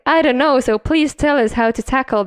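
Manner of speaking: ordinary talk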